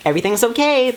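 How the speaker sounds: mock-bright, falsely upbeat reassurance; fake cheerfulness. Vlogger mocking fake fine pretence, mock-bright and falsely cheerful. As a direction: paste on a brittle pretend-fine tone. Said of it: "I'm not gonna be fake about it and be like".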